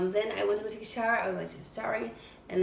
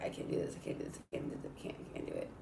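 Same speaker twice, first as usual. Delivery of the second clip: whispered, repeated under the breath; whispered panic; 10 dB quieter, faster than usual. Retold or imitated. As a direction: whisper it like you're terrified. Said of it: "I was like".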